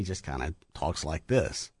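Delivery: low, subdued imitation; imitating a reserved, quiet talker; talks like this. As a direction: drop it down soft and unexcited. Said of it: "For the rest of the time".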